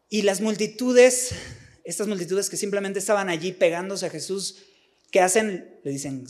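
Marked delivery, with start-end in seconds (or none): none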